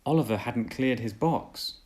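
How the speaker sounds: ordinary delivery, nothing marked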